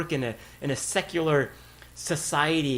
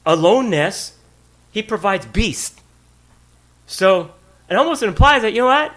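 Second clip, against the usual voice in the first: usual speaking voice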